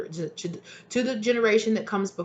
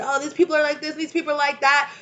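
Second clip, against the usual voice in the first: mocking complainers; 9 semitones higher, 3 dB louder than usual. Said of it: "you wanna talk about".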